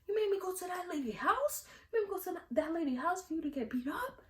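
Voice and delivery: high, whiny mother complaint; imagined mom scolding her kid. Retold or imitated.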